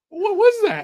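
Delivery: very high mock disbelief; astonished. Viewer reacting to the film gag, high astonishment. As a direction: squeak it out, utterly baffled.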